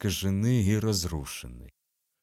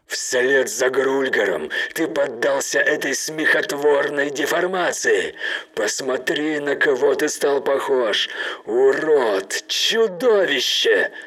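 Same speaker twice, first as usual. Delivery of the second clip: loud, higher, furious denunciation; angry shout; 5 semitones higher, 6 dB louder than usual. Acted. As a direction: condemn him, building to a yell. Captain condemns traitor.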